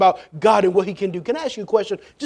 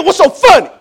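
high, loud, demanding dad; stern parental yell; getting serious; 10 semitones higher, 10 dB louder than usual. Acted. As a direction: demand an answer from giggling kids. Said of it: "You get serious".

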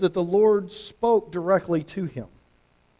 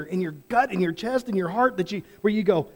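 ordinary delivery

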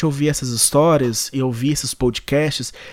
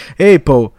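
loud call, rising to a higher pitch; 4 semitones higher, 9 dB louder, much slower than usual. Pretend. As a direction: shout it out to get noticed. Calling out to Apple.

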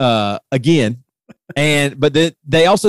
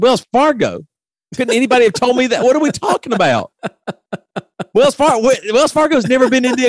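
retold incredulous outburst; 10 semitones higher than usual. Retold disbelief on the phone.

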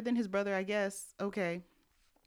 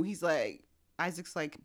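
normal talking voice

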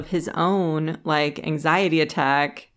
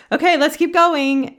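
high, louder offhand brush-off; mock casual husband; 11 semitones higher, 6 dB louder than usual. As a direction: ham up his breezy reply, not hearing her. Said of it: "he was like".

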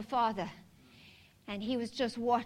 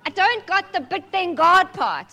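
excited; 7 semitones higher, 11 dB louder than usual. Real, not acted. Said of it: "laughing at herself".

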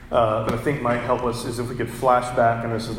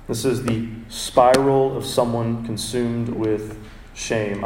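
ordinary talk, nothing marked

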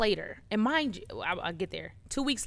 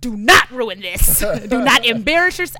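1 self-scolding inner voice; 11 dB louder than usual. Retold or imitated. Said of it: "I was like".

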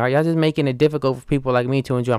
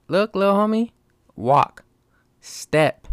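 mock scolding; 7 semitones higher, slower than usual. Tells a child to walk.

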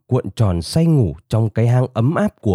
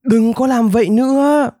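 high-pitched angry yell; small rabbit yelling high; 12 semitones higher than usual. A narrator's reading. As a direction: cry out in protest, pitched way up. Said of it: "gào lên".

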